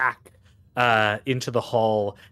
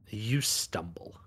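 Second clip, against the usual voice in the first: dramatic narration; 5 dB quieter than usual. Acted. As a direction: draw them into the dark. GM narrates blackout scene.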